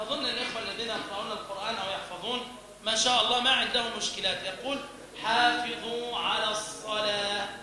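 usual speaking voice

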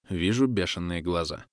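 usual speaking voice

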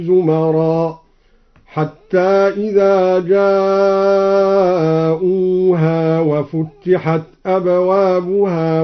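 slow Quran chant, وفتحت said "wa-futtihat"; doubled consonant in futtihat. Shown as a demonstration. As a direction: lengthen vowels, double the t in وفتحت. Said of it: "والموضع الثاني".

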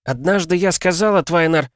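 high, impassioned yell; emotional high cry; shouted confession. A narrator's reading. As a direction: shout it across the storm. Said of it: "прокричала Миас".

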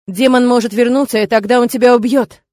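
usual speaking voice

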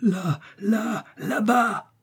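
high, strained cry toward the horizon; high-pitched exclamation of wild urgency; inhuman cry. A narrator's reading. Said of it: "d'une voix qui n'avait plus rien d'humain".

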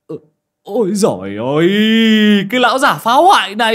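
high, loud startled shriek; a man's shrill howl of alarm; shrieking in dismay. Acted. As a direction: cry out as the gate crashes down. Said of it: "rú lên".

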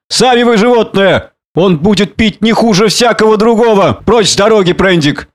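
put-on high, indignant drunken outburst; angry retort. A narrator's reading. Drunken man defends his servant against Prendick, angry retort. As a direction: throw the insult back and order him aside. Drunken quarrel, ordering him aside.

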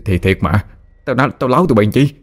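understated frightened pleading; trembling protest. A narrator's reading. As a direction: protest that you really saw it, a little rattled. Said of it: "giọng nói run run".

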